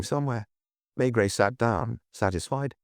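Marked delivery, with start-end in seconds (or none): none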